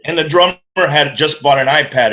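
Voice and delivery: usual speaking voice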